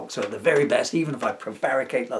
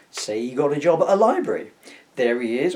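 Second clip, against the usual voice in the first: ordinary delivery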